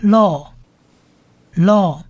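law as a drawn-out "lo"; pronunciation demo. Shown as a demonstration. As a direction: repeat law with a long o and a pause between. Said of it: "合起来就是念law".

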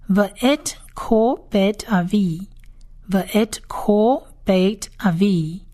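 "V'et kol bet avi" very slow, word by word; Hebrew reading demo. Shown as a demonstration. Teacher reviewing the father's house line, very slow Hebrew reading. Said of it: "再来复习一次所有的念法".

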